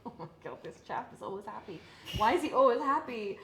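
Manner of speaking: rising into a loud high incredulous cry; mock bewilderment at a relentlessly cheerful coach; baffled disbelief. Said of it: "I'm like".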